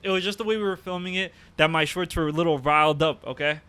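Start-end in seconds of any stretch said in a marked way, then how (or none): none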